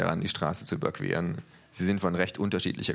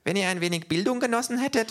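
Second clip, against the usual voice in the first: high, lightly voiced helpful kangaroo; high kangaroo, mock helpful; 4 dB louder than usual. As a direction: finish his sentence sweetly. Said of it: "versucht ihm das Känguru zu helfen".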